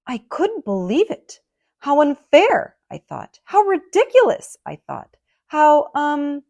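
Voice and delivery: loud, high outraged thinking; indignant. A narrator's reading. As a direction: huff at the unfairness. Indignant thoughts.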